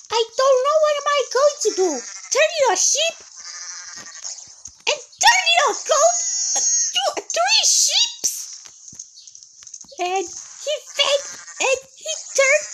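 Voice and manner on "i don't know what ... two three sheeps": high, loud, fast near-crying wail; panicked wail. Real, not acted. Player watches everyone turn into sheep, panicked crying wail.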